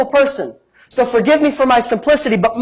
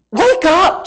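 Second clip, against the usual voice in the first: lightly voiced apostle's call, raised pitch; rousing call; 7 semitones higher than usual. Paul to the Roman church, lightly acted rousing call. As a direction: call out to rouse a sleeping church.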